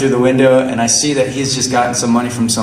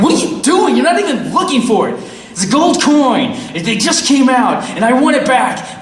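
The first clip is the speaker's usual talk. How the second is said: confronting the beggar in a higher voice; exasperated demand; 11 semitones higher than usual. Retold or imitated. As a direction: demand it back, fed up. Confronting the man for the coin.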